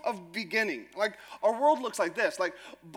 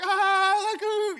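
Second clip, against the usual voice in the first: giggly swoon; 9 semitones higher, 4 dB louder, much slower than usual. Retold or imitated. Swooning teen on phone.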